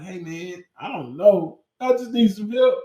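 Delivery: put-on a scared kid asking for help; whiny plea. Student answering his teacher, high and whiny.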